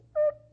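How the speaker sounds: a high, loud imitated monitor beep; flatline beep. Preacher mimics faith dying as a loud high beep. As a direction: squeal out a heart monitor's flatline tone.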